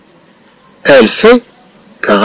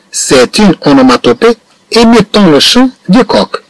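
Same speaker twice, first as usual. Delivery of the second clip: slow model reading; 5 semitones higher, much slower than usual. Shown as a demonstration. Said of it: "teacher models the French sentence".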